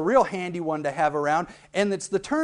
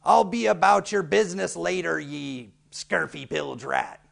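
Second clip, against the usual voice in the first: pirate voice. Shown as a demonstration. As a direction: sneer it like a pirate. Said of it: "such as".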